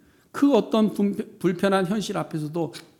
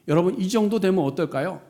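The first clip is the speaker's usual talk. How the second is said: ordinary talk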